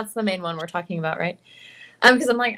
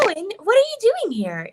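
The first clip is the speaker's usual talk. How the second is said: put-on much higher anime girl voice; your own voice, pitched up; Himiko voice; 10 semitones higher than usual. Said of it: "just a little bit higher".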